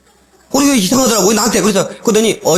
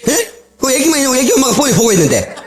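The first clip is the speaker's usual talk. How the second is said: ordinary talk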